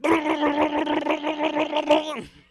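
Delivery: loud, high, juddering sputter; imitated seizure noise. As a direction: sustain a rapid blubbering convulsion sound. Acting out his seizure in bed.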